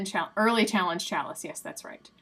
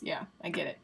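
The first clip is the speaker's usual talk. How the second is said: normal talking voice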